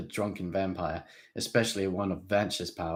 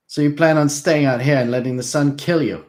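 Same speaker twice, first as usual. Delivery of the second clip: 1 challenging demand; 7 semitones higher, 9 dB louder than usual. A narrator's reading. Darren challenging drunk prince.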